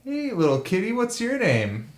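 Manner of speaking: put-on high sing-song baby talk; sing-songy. Druid coos a greeting to the cat, sing-songy. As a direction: coo at the kitty, up high. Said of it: "in a sing-songy voice".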